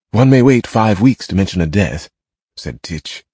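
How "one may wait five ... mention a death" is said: louder, curt and cutting; sharp tone. A narrator's reading. Titch rebuking his brother over the death, sharp. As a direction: snap at your brother over the delay. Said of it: "I do not understand your sharp tone".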